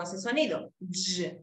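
short voiced "Y" buzz, cut off quickly; short sound demo. Shown as a demonstration. Teacher showing students the joined sound, short "Y". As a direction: say "Y" as a brief j sound. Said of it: "Es más corto".

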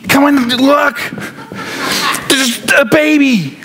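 high-pitched, eager announcement; hammed-up angel bursting with news; excited angel. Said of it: "this angel that's just so excited about this event".

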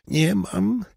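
exhausted rasp dying to a whisper; hoarse voice. A narrator's reading. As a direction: struggle out the words, voice giving out. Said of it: "chrypliwy głos Roberta".

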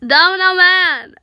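loud, high shout of a name; calling out. Acted. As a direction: yell for a missing friend. Acted call to missing character.

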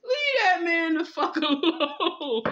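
cracking up mid-sentence; laughing disbelief. Real, not acted. Laughing at the reading in disbelief.

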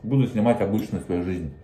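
speaking between bites; talks while chewing. Real, not acted. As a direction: mouth partly full. Seen 1: Took a forkful of noodles at -4s; lips pursed, chewing while speaking.

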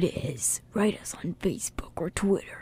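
soft unvoiced teaser whisper; playful whisper. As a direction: whisper it like a secret. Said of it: "teasing the audience".

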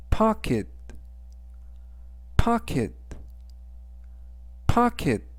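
pocket said slowly, high and clear, stress on po; pocket, each word pronounced carefully for learners; model pronunciation. Shown as a demonstration. Teacher modelling pocket for students, clear and slow. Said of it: "라고 발음하시면 되겠고요".

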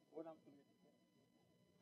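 slow, hushed whisper. Real, not acted. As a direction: whisper it as a warning. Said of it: "whispered sermon point".

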